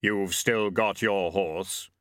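slow, hollow voice of Death. Acted. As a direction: Death speaking gravely, slowly. Death speaks.

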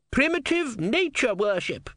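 high, slow scornful sneer; scoffing. A narrator's reading. Philosopher scoffing.

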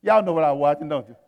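ordinary talk

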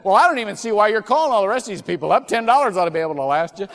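fast laughing joke. Retold or imitated. Joking reply while laughing.

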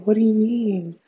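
ordinary delivery

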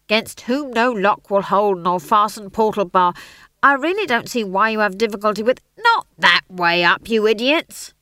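loud, fast stage director correcting the line; impatient director. Acted. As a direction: reel off the line in exasperation. Director snaps the line at actors.

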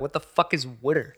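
teasing "water" said as WOOD-er; mocking Philly pronunciation. Clowning a Philly coworker's word, mocking pronunciation. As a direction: ask in mock bafflement, "water" as "wooder". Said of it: "would clown her the same way".

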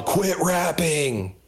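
mock crowd yell. Comic imagining the audience heckling his stand-up, mock yell. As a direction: stretch it out like a half-hearted heckler. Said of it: "they just all yell".

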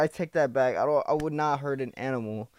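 ordinary talk

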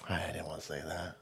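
very soft, low whisper; whispered aside. Real, not acted. Speaker excuses his own swearing, whispered aside. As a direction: take it back under your breath in a whisper.

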